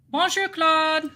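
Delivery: mock French greeting. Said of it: "I don't know if Claude speaks French".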